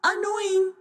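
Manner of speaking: high squawking parrot echo; high birdlike chorus. A narrator's reading. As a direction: squawk the words back like a mimicking bird. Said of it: "said the macaws together".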